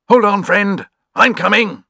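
high, urgent call to a friend; eager barking call. A narrator's reading. Dog calling to a trapped friend, high and urgent. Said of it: "barking for all he was worth, as if to say".

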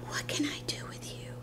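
soft whispered pet talk; hushed fond exasperation. Real, not acted. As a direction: whisper it lovingly to the puppy. Repeats it softly to the dog.

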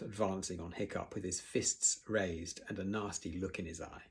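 ordinary delivery, nothing marked

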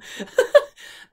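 a high airless burst of laughter; high breathy laugh. Real, not acted. After calling herself a bogan Aussie, a high breathy laugh. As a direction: laugh at your own joke, no voice.

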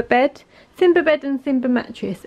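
usual speaking voice

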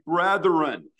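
"Brethren" punched out as a firm address; emphatic BRETHREN. Retold or imitated. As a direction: draw all attention to the word "Brethren". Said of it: "he puts a word in the front and he emphasizes".

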